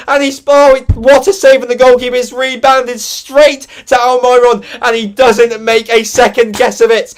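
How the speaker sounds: high, very loud ecstatic shouting; full-throated commentator scream as the winger scores; screaming goal call. Real, not acted. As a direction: lose it completely over the goal. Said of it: "apparently I was being a little bit too loud".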